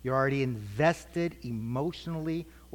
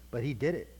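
ordinary delivery

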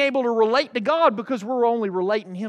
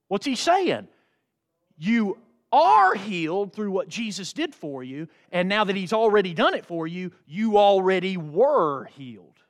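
ordinary talk, nothing marked

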